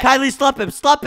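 high, loud command; urgent egging-on. Pretend. Player urging Kylie to attack, urgent shouting. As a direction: urge your teammate on loudly.

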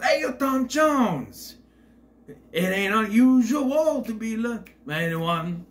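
loud, high, drawn-out quote. Retold or imitated. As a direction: belt it out slowly like a big greeting. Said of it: "one good line I like from him when he sees [name]".

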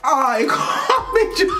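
high laughing, breaking up; cracking up mid-sentence. Real, not acted. Laughing fit.

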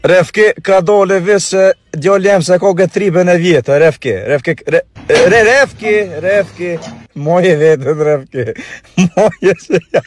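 loud calling out. Real, not acted. Calling out loudly to Refki.

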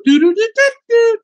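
very high, loud cartoon woodpecker trill; squeaky high cartoon bird laugh; Woody Woodpecker call. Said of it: "just like Woody Woodpecker".

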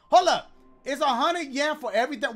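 high loud indignant stop; indignant hold up. Real, not acted. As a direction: stop them, outraged. Outraged at price.

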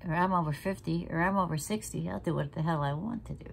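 ordinary delivery

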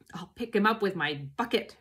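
ordinary delivery, nothing marked

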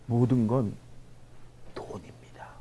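hushed, low aside; confidential whisper. Acted. Lecturer confiding what matters most to the audience, hushed whisper. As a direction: whisper it like an open secret.

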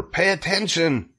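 high contained scream; high-pitched mock scream; urgent scream. A narrator's reading. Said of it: "screamed Wilbur".

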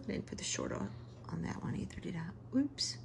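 a sheepish whisper to herself; whispered aside. Real, not acted. Teacher catching her own mistake, whispering. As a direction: admit the slip under the breath. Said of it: "whispered oops aside".

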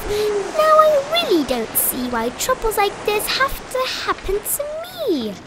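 high, plaintive self-pity; high-pitched whine. A narrator's reading. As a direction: whine it to yourself. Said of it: "And I said to myself".

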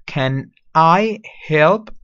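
"Can I help?" stretched out very slowly with a rise; very slow model. Shown as a demonstration. Teacher repeating "Can I help?" slowly for the class. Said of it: "Listen to the pronunciation".

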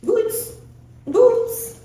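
high calling voice for a dog; talking to a dog. Real, not acted.

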